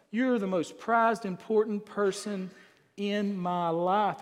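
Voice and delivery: slow, deliberate declaration of devotion; drawn-out, measured husband's compliment; acted heartfelt praise. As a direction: let each word land slowly for her. Voicing husband praising his wife.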